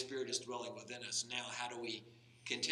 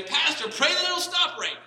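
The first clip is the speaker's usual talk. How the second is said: mock frantic farmer; 16 dB louder than usual. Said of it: "Then they'll say".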